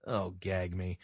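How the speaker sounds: low, soft, deadpan disgust; disgusted aside. Real, not acted. Host reacting to the mea culpa, disgusted aside. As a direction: drop low and quiet in contempt.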